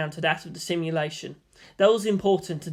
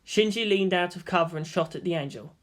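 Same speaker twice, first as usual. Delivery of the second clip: plain reading voice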